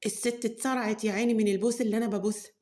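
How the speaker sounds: ordinary delivery, nothing marked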